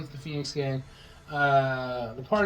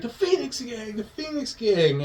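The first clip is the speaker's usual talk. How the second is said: high, pleading cry without volume; acted agony, pitched high; mock pained confession; 9 semitones higher than usual. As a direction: imitate a kid blurting it out in pain. Kid confesses under pain.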